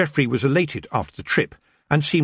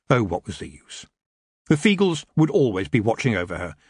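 plain reading voice

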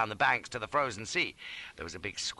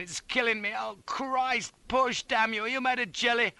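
high, frantic pained cursing; panicked high-pitched demand; agonised groaning; 12 semitones higher than usual. A narrator's reading. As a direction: wail and curse under a crushing weight. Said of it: "I groaned".

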